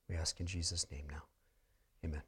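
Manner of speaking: hushed whisper. Real, not acted. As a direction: breathe the closing words. Whispered close of prayer.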